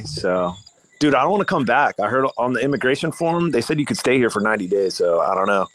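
ordinary talk

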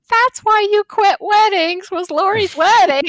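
very high, loud imitated outcry; exclaimed realisation. Imitating the family's later realisation.

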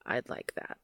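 whispered low and shy; soft low whisper. Acted. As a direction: breathe it shyly, deep voice. Shy Cass accepts.

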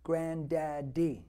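slow, drawn-out granddaddy, each syllable stretched; coaching a toddler. Retold or imitated. Grandfather teaching granddaughter her first word, slowly. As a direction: coax a baby into saying granddaddy. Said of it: "I always tell her".

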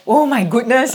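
loud gasping oh my goodness; exasperated exclamation. Retold or imitated. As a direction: react in alarm. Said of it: "I was like".

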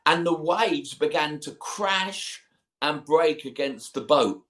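ordinary talk, nothing marked

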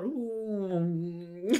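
long drawn-out aeroplane hum; childlike swooping plane sound; aeroplane noise. Acted. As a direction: imitate a plane like a playing child. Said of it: "play like an aeroplane".

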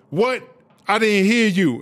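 mock challenge. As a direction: demand a repeat, playfully lording it over them. Acted challenge demanding a louder answer.

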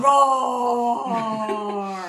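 loud mock roar; roaring lion. Joins the lion bit with a roar.

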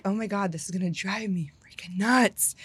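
exasperated whisper under the breath; retold whisper of annoyance; whispered frustration. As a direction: hiss it to yourself, fed up. Retold frustration at beeping alarm.

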